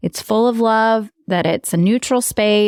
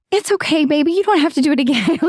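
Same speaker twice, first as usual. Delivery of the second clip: high, fast, coddling mom voice; sweet pushover mom talking to her kid; soothing mom voice; 7 semitones higher, much faster than usual. Retold or imitated. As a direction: coo like an overprotective mom. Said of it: "I am so that mom".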